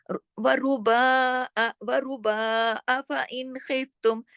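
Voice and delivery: slow recitation with a long baa in वरुबा; stretched baa. Shown as a demonstration. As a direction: draw out वरुबा, then measured इन खिफ्तुम. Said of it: "बा जो है वो खींचेगा एक अलिफ के बराबर". (वरुबा के बा को खींचकर तिलावत)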